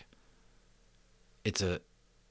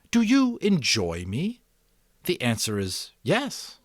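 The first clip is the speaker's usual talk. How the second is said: mother voice; 7 semitones higher, 5 dB louder, slower than usual. A narrator's reading.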